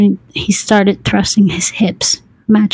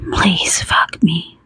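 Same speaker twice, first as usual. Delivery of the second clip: slow pleading whisper; hushed, drawn-out plea; breathed plea; 4 dB quieter, slower than usual. A narrator's reading. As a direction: breathe it out slowly, without voice. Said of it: "I breathed".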